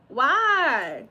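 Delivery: ordinary talk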